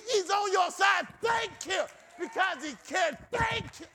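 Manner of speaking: high, driving revival exhortation; preaching climax. Real, not acted. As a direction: build to a fervent, shouted refrain. Preaching climax, calls to give thanks.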